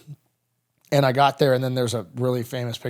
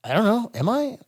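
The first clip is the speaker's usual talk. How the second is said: dazed and unsure; 10 semitones higher, 4 dB louder than usual. Retold or imitated. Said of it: "And I was like".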